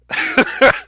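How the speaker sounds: sudden high cackling laugh; a quick, sharp, high laugh; high burst of laughter. Real, not acted. Laughing at the apology, a sudden high cackle.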